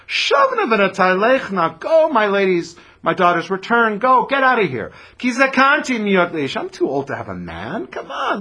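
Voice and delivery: put-on high, loud old woman pleading; Naomi, exasperated. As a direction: push them away in disbelief. Voices Naomi sending daughters away.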